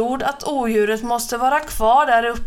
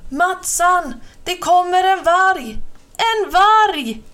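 panicked high, loud yell; terrified scream of wolf; roaring; 8 semitones higher, 5 dB louder than usual. Acted. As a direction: bellow a warning to your friend. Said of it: "Larsan vrålade".

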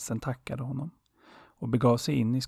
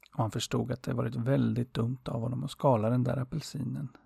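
ordinary delivery

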